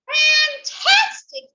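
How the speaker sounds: high, slow, drawn-out "Fantastic"; mock admiration. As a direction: flatter the dragon sweetly. Princess praising the dragon.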